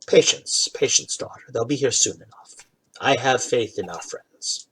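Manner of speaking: calm her down in a soft whisper; whispered reassurance. Acted. Father to worried daughter, hushed patient whisper.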